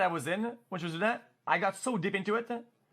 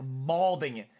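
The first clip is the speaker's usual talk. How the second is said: usual speaking voice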